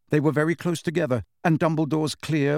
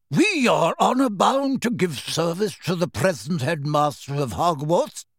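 put-on frail old wizard; 3 semitones higher than usual. A narrator's reading. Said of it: "cried a frail-looking old wizard".

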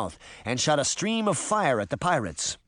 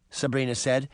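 usual speaking voice

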